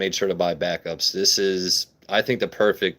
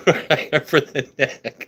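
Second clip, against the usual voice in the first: slow, higher, broken up by laughter; laughing; 5 semitones higher, much slower than usual. Real, not acted. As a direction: laugh through the punchline. Laughing at his dog joke.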